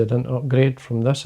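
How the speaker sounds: ordinary talk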